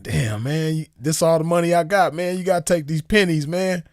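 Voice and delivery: high-pitched acted plea; retold pleading. Customer begging attendant to take pennies, acted high pleading. Retells pleading with station man.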